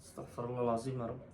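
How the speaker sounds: ordinary talk, nothing marked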